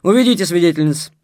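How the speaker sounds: authoritative, high-volume instruction; commanding order. A narrator's reading. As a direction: order the guards firmly. Guard orders prisoners removed.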